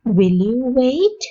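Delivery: slow question rising at the end; slow model question. Shown as a demonstration. Teacher modelling a polite question for learners, slow. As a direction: ask it slowly, lifting the last word. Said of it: "model question slowly".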